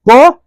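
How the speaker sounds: a crisp, punchy को; short demo syllable. Shown as a demonstration. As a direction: snap the को out quickly. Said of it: "ज्यादा समय किस में लग रहा है?".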